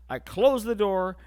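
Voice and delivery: ordinary delivery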